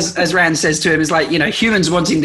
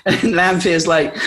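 normal talking voice

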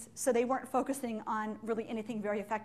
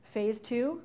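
normal talking voice

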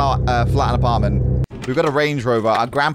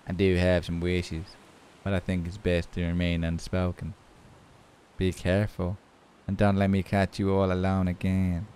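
low, soft, menacing monotone; creepy stranger; 6 semitones lower, 7 dB quieter than usual. Acted. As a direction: voice a creepy man, quiet and low. Reads creepy character's lines.

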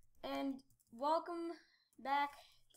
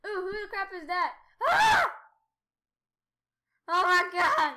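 high, loud panicked yell; real gamer panic; startled shout; 4 semitones higher, 11 dB louder than usual. Real, not acted. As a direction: yelp as the snake closes in. Attacked by a snake.

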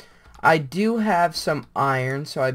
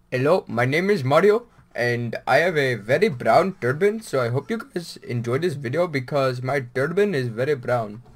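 hammed-up Indian accent, louder and sing-song; mock Indian accent; 4 dB louder than usual. Gamer to viewers, joking in a mock Indian accent. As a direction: play a comic Indian-accented shopkeeper. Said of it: "Should we just talk like this".

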